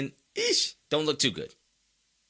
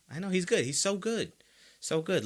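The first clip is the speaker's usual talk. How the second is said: sarcastic agreement; 6 semitones higher than usual. Pretend. Humouring the fans sarcastically.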